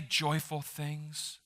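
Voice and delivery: soft and slow, Mister Rogers-like; mock soothing tone. Shown as a demonstration. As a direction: coo it gently like a kids' TV host. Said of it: "Have that nice soothing tone to your voice".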